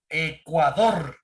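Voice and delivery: "Ecuador" drawn out slowly, syllable by syllable, last one strongest; stress on the last syllable. Shown as a demonstration. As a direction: say "Ecuador" slowly, leaning hard on the final "dor". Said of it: "la fuerza de voz está en la última sílaba". ("Ecuador" muy lento, con la fuerza de voz en la última sílaba)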